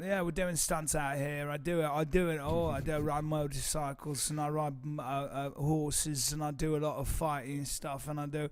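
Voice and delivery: put-on laid-back English accent to impress girls; English stuntman. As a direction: brag casually in a fake English accent. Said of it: "we were stuntmen from England".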